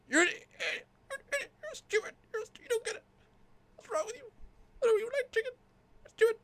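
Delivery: squeaky rant. Acted. Puppet splutters at the steward. Puppet rants in a high pitch.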